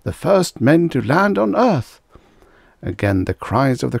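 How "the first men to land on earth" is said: high, drawn-out rapture; gushing. A narrator's reading. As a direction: marvel grandly for the crowd. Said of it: "the mayor gushed".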